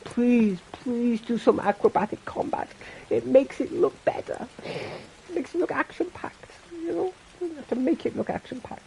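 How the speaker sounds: high, pleading mock voice, soft and breathy on look action-packed. Begging teammate to fight flashier, mock pleading voice. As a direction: beg a teammate in a high put-on whine. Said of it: "I was just like".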